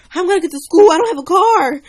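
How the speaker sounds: high, loud panicked cry over the dead car; shouting in alarm, voice jumping up. Retold or imitated. Stranded girl panics aloud about getting to school. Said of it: "And I'm getting scared".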